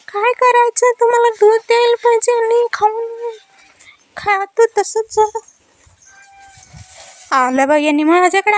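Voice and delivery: high pet voice grumbling about being filmed; complaining pet. Pretend. Owner voicing her pet annoyed at being filmed, high grumbling voice.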